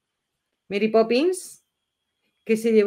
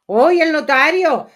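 drawn-out delighted cry, louder; a louder, slower gleeful oy; excited exclamation; 5 semitones higher, 8 dB louder, slower than usual. Real, not acted. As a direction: call out in delight at spotting him. Spots the notary on screen.

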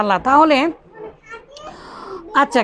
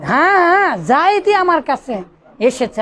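হ্যাঁ, হ্যাঁ raised and pushed, Zaidi stressed; emphatic yes; 2 semitones higher than usual. Shown as a demonstration. Teacher acting out an emphatic answer, হ্যাঁ, হ্যাঁ stressed. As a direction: insist that Zaidi came. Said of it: "জোর দিচ্ছে".

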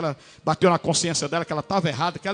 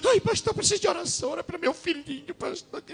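much higher, fading mock wail; high, softer, imitated sobbing plea; crying mother; 9 semitones higher, 4 dB quieter than usual. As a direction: imitate her tearful plea for prayer. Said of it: "ela chegou chorando na igreja".

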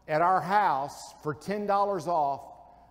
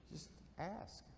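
whisper; 21 dB quieter than usual. Real, not acted. Pastor closes on a soft aside.

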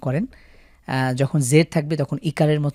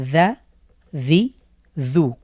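দাদিদু read very slowly, syllable by syllable; slow careful reading; much slower than usual. Shown as a demonstration. Teacher slowing down দাদিদু for beginners, careful and short. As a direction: ease through দাদিদু slowly, keeping vowels short. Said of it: "ধীরেও পড়েন".

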